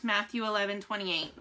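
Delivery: normal talking voice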